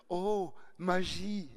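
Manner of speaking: marvel, drawing it out slowly; dazzled exclamation. Retold or imitated. The girl in Switzerland marvelling at the accent, drawn-out. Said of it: "fit-elle".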